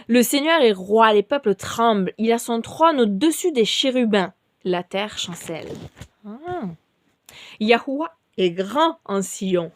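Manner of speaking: measured, rising psalm reading; solemn scripture reading. Acted. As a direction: proclaim each verse. Reads a psalm aloud.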